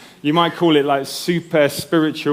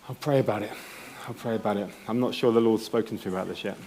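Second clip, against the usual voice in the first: low-key, softly voiced brush-off; quietly acted noncommittal answer; hesitant reply, acted; 3 semitones lower, 9 dB quieter, faster than usual. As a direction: imitate someone gently stalling, much softer. Acting out the reluctant volunteer's reply.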